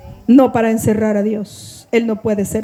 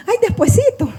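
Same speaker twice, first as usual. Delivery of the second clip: higher-pitched mock excuse; putting it off; 9 semitones higher than usual. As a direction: brush it off with a high, cutesy "later". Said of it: "voy a seguir diciendo".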